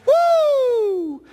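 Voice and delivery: high, loud playful whoo of wind; a comic gust-of-wind squeal; mock whoosh. Preacher joking about skirts in wind, high playful whoo.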